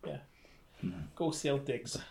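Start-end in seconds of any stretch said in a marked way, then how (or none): 1.2-2.0 softer mock Boston cheer; low-key cheer in a Boston accent. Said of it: "try doing a Boston".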